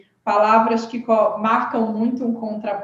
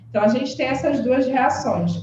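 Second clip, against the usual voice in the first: normal talking voice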